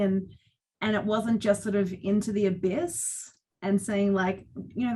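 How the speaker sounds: ordinary talk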